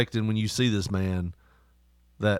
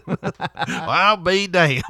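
slow, higher Southern "I'll be damned"; Southern drawl; 5 semitones higher, 4 dB louder, much slower than usual. Retold or imitated. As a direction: drawl it out in awe. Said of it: "just as Southern".

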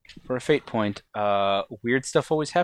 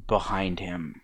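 eerie scene setting; 3 semitones lower, 5 dB quieter than usual. Acted. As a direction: trail into an ominous near-whisper. Game master's eerie scene setting.

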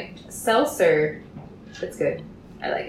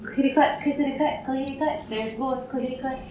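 singsong clacking; 7 semitones higher, faster than usual. Acted. Mimics dog claws on floor.

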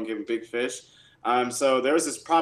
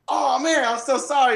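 high, loud mock-embarrassed apology; a hint of a flustered homeowner; apologetic surprise; 13 semitones higher, 7 dB louder than usual. Homeowner apologizing to the realtor he chased off, flustered. Owner realizes his mistake.

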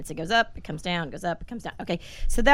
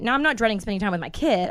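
usual speaking voice

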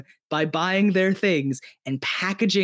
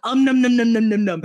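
mock eating noises; 6 semitones higher, 6 dB louder than usual. Mimicking people eating it up.

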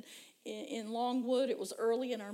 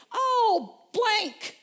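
high, loud playful "Oh, blank"; mock exclamation; 10 semitones higher, 10 dB louder than usual. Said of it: "And I say".

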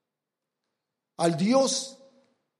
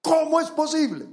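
¿Cómo es posible? cried out higher, with ¿Cómo? hit hard; indignant, higher exclamation of ¿Cómo es posible?; exclaimed; 7 semitones higher than usual. Acted. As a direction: exclaim it in disbelief. Preacher's indignant rhetorical question.

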